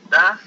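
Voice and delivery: Does called out high and loud as da; saying does. Shown as a demonstration. Teacher starts the example question for students, loud and high. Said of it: "significa ella".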